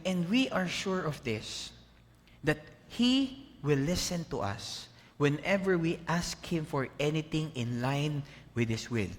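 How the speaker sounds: slow, solemn Bible reading; scripture reading. Acted. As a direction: read the verse slowly. Reads Bible verse. (mabagal na pagbasa ng Bibliya)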